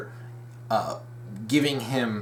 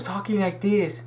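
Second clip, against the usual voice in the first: lightly imitated Chinese accent, higher; 5 semitones higher than usual. Shown as a demonstration. Fan demonstrating Mei Ling's old voice, mock Chinese accent. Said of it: "really stereotypical Chinese accent".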